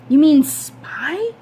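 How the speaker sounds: put-on boy shocked at the idea of spying; surprised question. A narrator's reading. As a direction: stretch it out, startled. Boy startled by suggestion.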